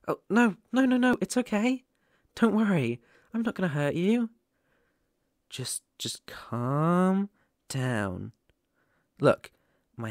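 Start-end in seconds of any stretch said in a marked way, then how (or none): 0.1-4.3 startled reassurance. Acted. Startled listener, soothing them. 5.5-8.3 slow, hushed plea to calm down; soothing whisper. Acted. Whispering slowly to the scared new werewolf. Calming a frightened listener.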